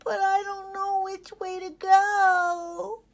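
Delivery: high, loud, slow plea; pleading cry. Acted. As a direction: beg the foxes for help. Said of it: "cried Ollie".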